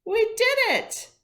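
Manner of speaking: loud, high joyful cheer; girl cheering after winning; whooping. A narrator's reading. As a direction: whoop with victory. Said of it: "Imogene whooped".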